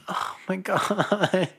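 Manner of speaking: high, soft, drawn-out laugh of being moved; touched, voice up and slowed, laughing softly; getting emotional. Real, not acted. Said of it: "I'm gonna get emotional".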